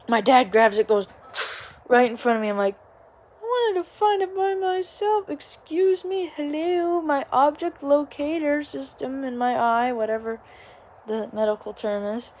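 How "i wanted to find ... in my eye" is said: exasperated. Retold or imitated. Exasperated retort to dad.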